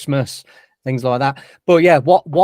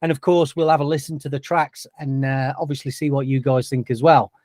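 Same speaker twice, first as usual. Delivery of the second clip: normal talking voice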